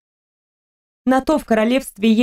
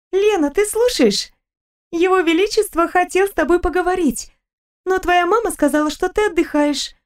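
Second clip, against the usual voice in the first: put-on higher, lively young woman; Stella's voice; 8 semitones higher than usual. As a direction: chatter happily about a trip.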